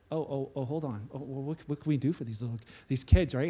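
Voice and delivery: suddenly tender passengers; hushed sympathy. Retold or imitated. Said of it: "Everybody's like".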